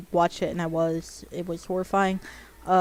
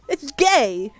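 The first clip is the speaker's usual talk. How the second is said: excited; 6 semitones higher, 7 dB louder than usual. Real, not acted. Excited declaration about the anime.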